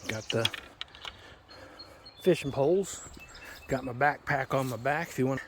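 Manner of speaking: ordinary delivery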